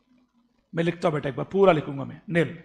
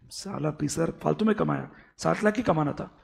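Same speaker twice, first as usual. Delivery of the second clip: grumbling regret; 5 semitones lower, 6 dB quieter than usual. Retold or imitated. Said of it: "अब ये बोल रहा है".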